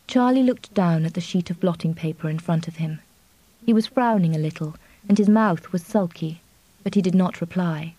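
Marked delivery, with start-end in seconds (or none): none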